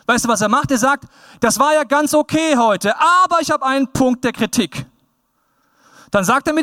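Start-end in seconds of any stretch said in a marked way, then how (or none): 1.4-4.9 higher-pitched, put-on polite critic; raised voice, mildly mocking; mock feedback giver. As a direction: imitate someone leading up to criticism. Acts out a critic giving feedback.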